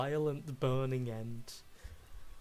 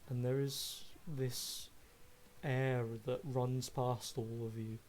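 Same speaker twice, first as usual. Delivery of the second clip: ominous GM narration; 4 dB quieter than usual. Acted. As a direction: build dread as the shadow looms. GM describes looming shadow.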